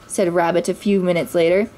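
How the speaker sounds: ordinary delivery, nothing marked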